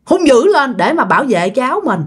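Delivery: usual speaking voice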